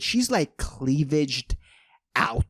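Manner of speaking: ordinary delivery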